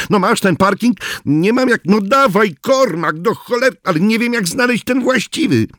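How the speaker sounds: high, pressed and urgent, swearing in frustration; desperate cursing. Acted. Oriński cursing at the phone, urging Kormak to find the parking. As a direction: push him hard, near panic. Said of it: "Oriński klął".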